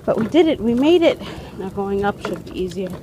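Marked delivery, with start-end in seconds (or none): 0.1-1.2 triumphant and loud; excited. Real, not acted. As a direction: cheer the small win. Triumphant after stairs.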